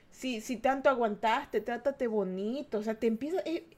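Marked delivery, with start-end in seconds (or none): none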